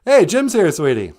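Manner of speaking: usual speaking voice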